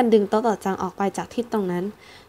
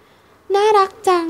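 put-on high, very slow, melting child voice; drawn-out childish delight; charmed little girl; 10 semitones higher, 5 dB louder, much slower than usual. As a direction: coo over the fluffy chick.

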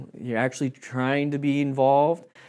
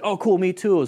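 higher, louder eager agreement; light up at the shared field; excited reply; 8 semitones higher, 5 dB louder than usual. Retold or imitated. To a fellow medic, an excited mock reply. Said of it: "it's like".

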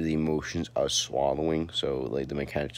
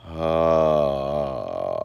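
vocal fry; 4 dB louder than usual. Shown as a demonstration. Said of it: "so let me demonstrate".

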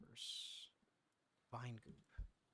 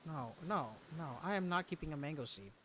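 usual speaking voice

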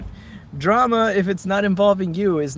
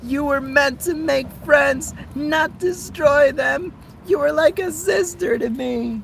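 higher, slow betrayed pony voice; Rainbow Dash voice; 10 semitones higher than usual. Acted. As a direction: feeble Rainbow Dash impression, much higher. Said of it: "feeble attempt at a Rainbow Dash voice".

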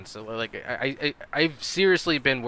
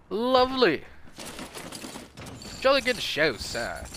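hammed-up upper-class British accent, higher; 7 semitones higher, slower than usual. Gamer slipping into a posh British accent mid-match. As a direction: play a posh British gent, mock polite. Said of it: "I'm not trying to be racist".

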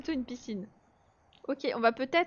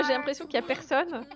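usual speaking voice

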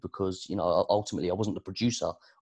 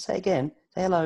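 put-on high-pitched, eager parent prompting a baby; excited coaxing; 10 semitones higher, faster than usual. Dad wanting baby's first word repeated, excited coaxing. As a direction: urge the baby on, voice jumping up.

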